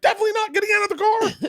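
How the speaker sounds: high, loud scared shout; frightened shout. Retold or imitated. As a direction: yell it trembling from inside the car. Scared refusal from locked car.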